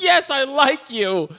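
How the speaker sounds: high, drawn-out mock surrender; bullied admission. Bullied girl finally answering Elliot, mock exasperated. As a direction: imitate a kid forced to say it. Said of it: "bullies her into basically be like".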